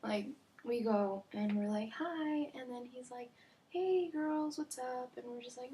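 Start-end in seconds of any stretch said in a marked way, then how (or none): 2.0-2.5 high, drawn-out sing-song hi; mock friendly greeting. Two girls greet their neighbor, mock friendly. 3.7-5.1 high, breezy imitated hello; mock friendly greeting. Neighbor greets two girls walking a dog, mock friendly.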